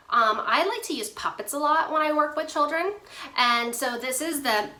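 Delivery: ordinary delivery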